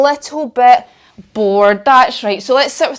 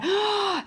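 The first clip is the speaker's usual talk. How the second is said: mock gasp; 9 semitones higher, 11 dB quieter than usual. Finding a bear in cave.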